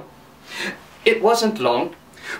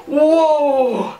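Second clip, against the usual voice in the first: whoa call; 7 semitones higher than usual. Acted. Horse stop call.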